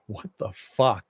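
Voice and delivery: drawn-out, stunned reaction, lightly acted; stunned disbelief. Recapper voices Major staring at Crawford, in stunned disbelief. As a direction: stretch out a mock-baffled reaction. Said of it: "Major's kinda looking at him like".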